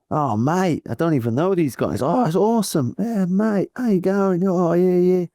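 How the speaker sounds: overjoyed rider greeting roadside fans; excited Plappy. Retold or imitated. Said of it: "full on Plappy style".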